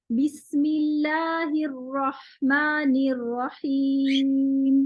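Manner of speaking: slow recitation with हीम held four counts; slow Quranic recitation, final vowel lengthened; four-count stop. Shown as a demonstration. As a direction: draw out Rahim at the stop. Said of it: "ये हीम जो है ये मैं चार हरकत तक लंबा करूंगी".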